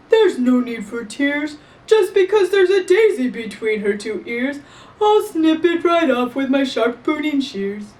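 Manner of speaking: lightly voiced brisk florist; a hint of a matter-of-fact florist. The florist offers to snip off the daisy, lightly voiced. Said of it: "said the florist".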